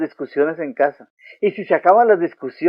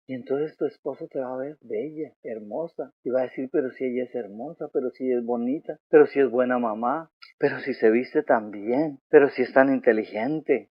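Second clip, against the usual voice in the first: much softer, hushed and marvelling; tender admiration; 4 semitones lower, 7 dB quieter than usual. Acted. Husband quietly marvelling at his wife's beauty, tender. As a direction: breathe it like a husband seeing her anew.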